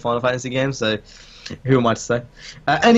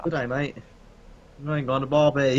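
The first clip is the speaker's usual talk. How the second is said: softer put-on Aussie voice; casual mock Australian; Australian accent attempt; 4 dB quieter than usual. Shown as a demonstration. Friend having another go at the accent game, mock Australian. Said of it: "saying Australian accents".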